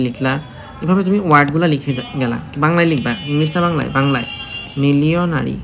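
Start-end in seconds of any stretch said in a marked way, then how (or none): 4.8-5.6 মিলিয়নারি said carefully as the teacher says it; careful demo of মিলিয়নারি; pronunciation demo. Shown as a demonstration. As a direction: spell out মিলিয়নারি in your own pronunciation, falling at the end. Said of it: "আমি উচ্চারণ করি এইভাবে".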